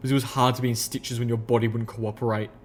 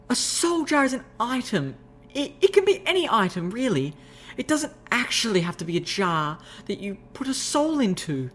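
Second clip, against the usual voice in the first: high refined lady's voice, explaining; refined unicorn explaining; 11 semitones higher than usual. Acted. Rarity explaining soul jars, high refined voice.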